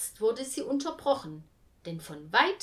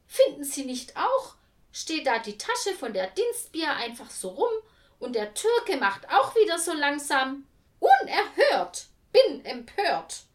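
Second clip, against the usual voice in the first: gossipy indignation; 7 semitones higher, 8 dB louder than usual. A narrator's reading. Jutta Mecke grumbles to Tante Lu, huffy mock outrage. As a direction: play the outraged gossip, milk Unerhört. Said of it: "sagte sie".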